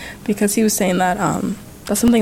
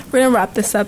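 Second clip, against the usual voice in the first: ordinary talk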